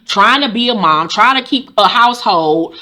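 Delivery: normal talking voice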